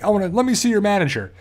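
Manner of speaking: imitating a Karen asking for the boss; Karen impression. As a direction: put on a mild mock-entitled complaint. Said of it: "It's like a Karen moment".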